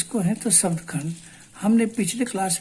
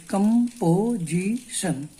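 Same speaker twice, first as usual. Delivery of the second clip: very slow, each syllable its own push; broken into syllables; much slower than usual. Shown as a demonstration. Teacher splitting the word for students, slow syllable by syllable. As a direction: tap out composition one stroke at a time. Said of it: "इसको तोड़िए".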